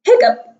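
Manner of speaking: squeaky high hiccup sound; a sudden high-pitched hiccup. Acted.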